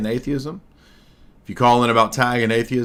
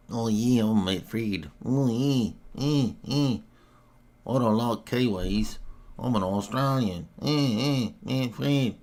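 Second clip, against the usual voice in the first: a hammed-up Australian accent, softer and laid-back; mock Australian accent; 6 dB quieter, slower than usual. Mocking Matt Fradd's Australian accent for the stream audience. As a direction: take the mickey with a lazy Australian accent. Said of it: "talks in his ridiculous Australian accent".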